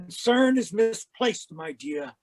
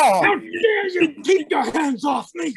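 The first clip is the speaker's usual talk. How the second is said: put-on indignant old man, louder and higher; outraged; 7 semitones higher, 5 dB louder than usual. Old man shouting at his attacker in outrage. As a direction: protest furiously while being grabbed.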